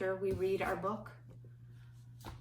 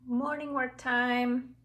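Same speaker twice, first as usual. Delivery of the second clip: loud, slow announcement; 6 semitones higher, 8 dB louder, slower than usual. Shown as a demonstration. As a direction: call it out, drawn out. Announcing schedule item.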